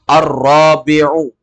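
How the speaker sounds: Ar-rabi'u said slowly and loudly, each sound drawn out; slow model reading. Shown as a demonstration. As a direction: read Ar-rabi'u slowly and clearly for the class. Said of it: "Lihat di papan tulis".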